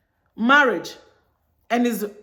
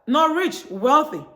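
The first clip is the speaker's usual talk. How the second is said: "rich" pitched up, then "wealthy" landed firmly; contrastive stress; 4 semitones higher, slower than usual. Shown as a demonstration. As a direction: set "rich" against "wealthy" and stress both. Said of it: "there's rich and there's wealthy".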